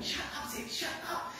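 whispered scolding of Sid the lead dog. Retold or imitated. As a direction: scold the dog without raising your voice. I don't wanna yell at him.

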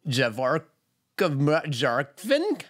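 Javarkavjarkvin drawn out very slowly, syllable by syllable, a tentative Icelandic try; attempting Icelandic. Shown as a demonstration. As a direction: stumble carefully through Javarkavjarkvin. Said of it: "I wasn't gonna attempt to spa-, say that either".

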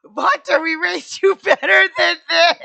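loud, high-pitched mock scolding; angry parents. Ghost parents scold Hunter over the crying baby, mock angry yell. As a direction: yell it like outraged parents, hammed up. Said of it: "they're both really angry".

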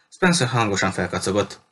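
plain reading voice

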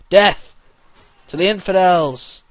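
high, loud war cry; battle cry. Pretend. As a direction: roar it charging in.

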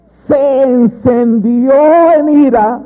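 "se encendió en ira" stretched out high and loud; drawn-out, proclaimed "se encendió en ira"; emphatic repetition. Acted. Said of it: "Y oiga cómo recalca".

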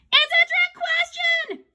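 very high, loud protest; shrieked objection; shouted. A narrator's reading. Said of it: "Andrea shouted".

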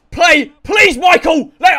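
loud, high plea at the screen; shouted please; pleading shout. Real, not acted. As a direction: beg the player to score. Begging player during match.

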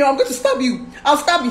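threatening, high and loud; retold angry threat. Woman replaying her threat to stab her partner, angry. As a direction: replay a heated threat at full voice. Quoting self in retold quarrel.

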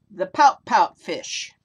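title called out loud and slow; announced title. Shown as a demonstration. Reader to children, announcing the title loud and slow. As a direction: proclaim the book title with a big slow voice.

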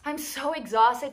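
breathless after getting home; puffing between words; out of breath. Real, not acted. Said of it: "And I'm out of breath".

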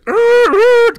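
high, loud imitated tire screech. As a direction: screech like skidding cars. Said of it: "cars screeching all over the place".

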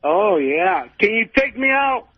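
ordinary talk, nothing marked

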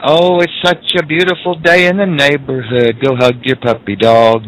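high, syrupy cheerful voice; mock sugary cheer. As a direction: sound sickly sweet and naive. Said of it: "for saying".

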